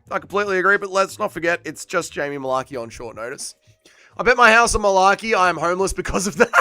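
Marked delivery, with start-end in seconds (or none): 4.2-6.5 loud, raised, breaking into a laugh; laughing read-out. Real, not acted. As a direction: read it out loudly, tickled, cracking up. Laughing at a chat message.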